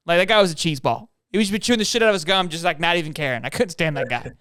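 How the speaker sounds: ordinary delivery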